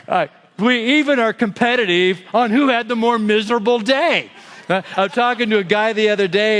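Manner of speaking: ordinary delivery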